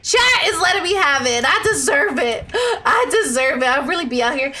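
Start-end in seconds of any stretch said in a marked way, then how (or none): none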